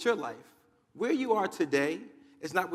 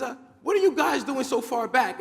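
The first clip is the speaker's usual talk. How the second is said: high, loud, surprised question; astonished chicken shouting back; baffled calling back; 9 semitones higher, 7 dB louder than usual. Acted. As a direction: call back to those behind, pitched up. Chicken calls back to flock.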